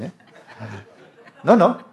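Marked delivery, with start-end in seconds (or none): 1.4-1.8 high, loud insistent no in mimicked voice; imitating His Holiness. His Holiness rejecting the interpreter's point, high loud mimicry. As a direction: do the teacher again, cutting in hard. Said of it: "It's a pretty close, direct quote".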